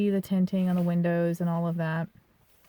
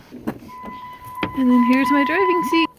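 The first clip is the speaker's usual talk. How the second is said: high-pitched; 12 semitones higher, 7 dB louder, much slower than usual. Real, not acted. Showing off driving seat.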